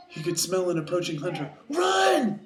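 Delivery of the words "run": high, loud shout of alarm; cried warning. Acted. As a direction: yell a warning as you flee. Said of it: "he cried as he scampered off".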